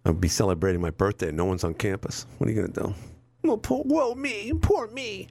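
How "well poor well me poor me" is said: mock self-pity. Self-pity joke about birthday.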